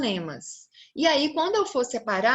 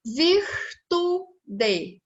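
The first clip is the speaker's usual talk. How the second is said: VIRTUDE higher, in three beats, vir-tu-de, final DE fully sounded; split into syllables; 5 semitones higher than usual. Shown as a demonstration. Teacher counting three syllables, syllable by syllable. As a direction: break virtude apart syllable by syllable. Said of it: "Eu tenho três sílabas".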